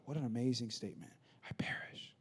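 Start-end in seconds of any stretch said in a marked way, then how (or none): 1.5-2.1 hushed, voiceless echo of the quote; very soft, reverent whisper; awed whisper. Retold or imitated. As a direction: whisper it, letting the words sink in. Repeats Esther's words in awe.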